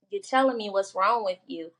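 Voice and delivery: ordinary talk, nothing marked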